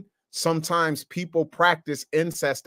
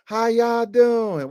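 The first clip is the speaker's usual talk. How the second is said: slow, higher-pitched Southern drawl; Southern accent; 6 semitones higher, 4 dB louder, much slower than usual. Acted. Southern cousins greet relatives at the family reunion, slow Southern accent. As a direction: imitate a drawn-out Southern greeting. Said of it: "they come with them accents too".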